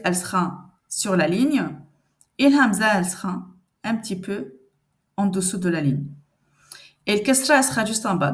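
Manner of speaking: usual speaking voice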